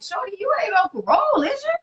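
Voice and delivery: high, incredulous question rising at the end; teasing accusing ask; hammed-up disbelief; mock suspicious boyfriend. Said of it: "He was like".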